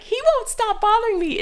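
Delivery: put-on very high, whiny child voice; high tattling whine. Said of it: "And I was like".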